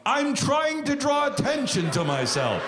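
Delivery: mock heckler yell. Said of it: "don't yell out shit like".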